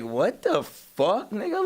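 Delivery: slow then squeaking up; stretched, rising outrage; shocked disbelief. Retold or imitated. Retold shocked reaction.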